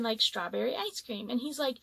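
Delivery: usual speaking voice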